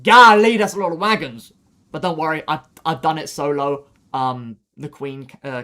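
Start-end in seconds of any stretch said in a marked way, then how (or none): 0.0-1.5 loud, hammed-up gasp of a dismayed teammate; mock dismay. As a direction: throw out a mock "Golly" much louder. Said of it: "you might think".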